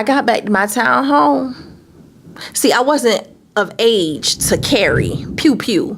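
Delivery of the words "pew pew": whispered gun noise. Retold or imitated. Mimicking gunshots.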